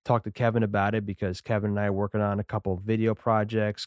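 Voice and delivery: ordinary talk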